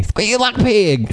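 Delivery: slow backwoods Southern drawl; drawn-out Southern movie quote; Southern accent. Acted.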